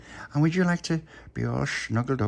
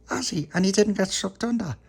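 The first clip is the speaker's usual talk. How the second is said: put-on high, surprised child voice; a wide-eyed little boy asking; Tony's voice; 10 semitones higher than usual. Tony voiced in story.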